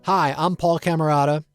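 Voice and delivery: usual speaking voice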